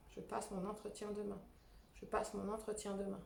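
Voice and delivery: soft tapping mantra. Shown as a demonstration. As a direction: say it gently to yourself while tapping. Repeats the tapping phrase. (mantra répété plus doucement)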